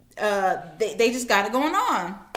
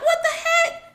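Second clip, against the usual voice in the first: shocked friends. Acted. Friends shocked at Job's state.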